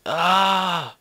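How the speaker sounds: high, drawn-out "ah" yell; loud cry. Acted. Character losing hold on a ledge, loud high cry. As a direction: cry out as the grip gives way.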